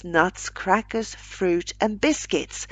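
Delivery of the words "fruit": ordinary delivery, nothing marked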